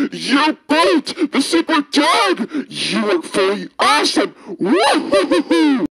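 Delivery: high, loud, giddy excitement; gushing fanboy. Acted. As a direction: burst with fan joy. Fanboy gushing over hero.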